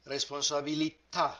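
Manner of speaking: "Responsabilità" slides along, then stops abruptly on the final "tà"; final stress demo. Shown as a demonstration. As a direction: glide through "Responsabilità" and stop dead on "tà". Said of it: "Here is an example".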